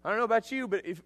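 normal talking voice

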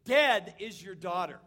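"Dead" thrown to the front, loud and slow; stress on Dead. Shown as a demonstration. Preacher showing the Greek word order, "Dead" loud and slow. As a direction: hit "Dead" hard and slow, first. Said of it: "that their emphasis".

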